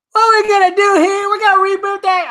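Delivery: a badly faked Chris Rock voice; failed Chris Rock. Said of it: "I can't even do a Chris Rock".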